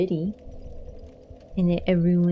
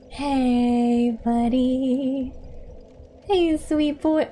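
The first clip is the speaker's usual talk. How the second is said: sweet cat talk; 4 semitones higher, much slower than usual. Real, not acted. Owner greeting her cat in high tender baby talk.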